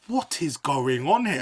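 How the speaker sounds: high, loud and slow, aghast at the scene. Retold or imitated. Son woken at dawn, aghast at his uncle's stunt, high and loud. Retelling shock at the Skybox.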